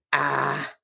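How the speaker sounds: short low dismissive "Ah" waved off, Dominican style. Retold or imitated. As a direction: brush it off with a Dominican "Ah". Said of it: "doing that Dominican".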